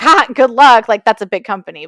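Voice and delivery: a dry, loud scoff at her plan; mock-dismissive loud scoff; scoffing good luck. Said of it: "the most unsupportive response".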